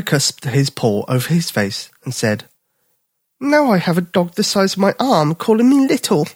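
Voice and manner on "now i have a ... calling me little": high, mock-offended cub; a cub miffed at being called little; indignant young bear. Bipper grumbling at the dog calling him little, higher cub voice.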